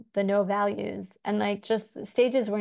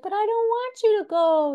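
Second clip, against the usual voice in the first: little brother; 13 semitones higher, 6 dB louder than usual. Acted. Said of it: "He's like".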